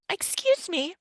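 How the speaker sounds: put-on bright lifted old lady voice; bright old woman. A narrator's reading. Kind old woman greeting the worried parents, bright. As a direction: chirp it like a sweet granny. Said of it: "she said brightly".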